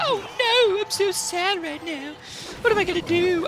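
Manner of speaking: high whiny voice of a sad loser; imitating the opponent whimpering in a high voice; mock crying opponent. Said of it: "and he's crying. He's like".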